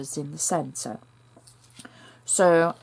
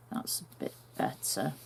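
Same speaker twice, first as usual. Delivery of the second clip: relieved murmur; 9 semitones lower, 12 dB quieter than usual. Real, not acted. Muttered relief after fixing.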